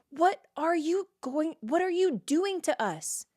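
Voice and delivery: high, slow, pleading cry; the men of Judah cry out to Samson, high and pleading; exasperated plea. Acted.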